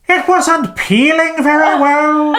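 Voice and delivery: comic Irish, rising high on the punchline; hammed-up Irish, drawn-out high finish; Irish leprechaun accent. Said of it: "a leprechaun".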